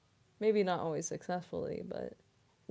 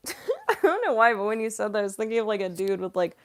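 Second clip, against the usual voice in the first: ordinary talk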